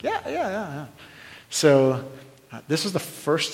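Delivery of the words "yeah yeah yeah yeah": high, softer string of quick yeses; eager agreement. Retold or imitated. Acted eager reply to youth pastor.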